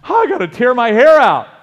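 high, loud exasperated cry; mock despair. Preacher voicing a frantic Moses at the Red Sea, loud despair. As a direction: throw up your hands in a loud wail.

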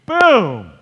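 sudden loud, high burst; big booming sound-effect word; explosive exclamation. Acted. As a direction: hit it like a thunderclap. Storm strikes in the retelling.

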